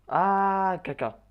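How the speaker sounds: sudden high loud yelp; startled cry. Real, not acted. Gamer reacting to a unit being shot, startled loud cry. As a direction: jump at the bad surprise.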